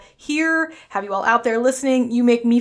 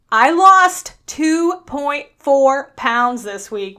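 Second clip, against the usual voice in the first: slow, loud and proud, New York accent reveal; excited announcement; 3 semitones higher, 5 dB louder, much slower than usual. Real, not acted. Host tells listeners her weekly loss, slow and proud. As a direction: savor every word of the good news.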